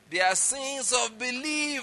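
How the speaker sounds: high, drawn-out proclamation; emphatic declaration. Retold or imitated. Retelling a preacher's claim to the congregation, emphatic. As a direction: stretch it out emphatically, pitch lifted high. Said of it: "he said it emphatically".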